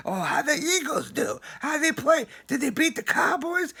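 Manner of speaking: high, mocking coworker imitation; nagging coworker voice. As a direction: ape the pestering guy at work. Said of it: "I need to be harassed every day at work".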